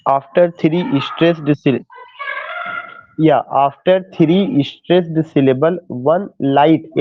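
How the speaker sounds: very slow, word by word, for note-taking; slow dictation. Shown as a demonstration. Teacher dictating to students taking notes, very slow. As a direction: spell it out so students can write.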